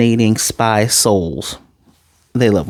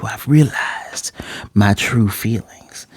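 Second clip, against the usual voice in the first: whispering; 3 dB quieter, slower than usual. Retold or imitated. Seductive confession in Twilight mode.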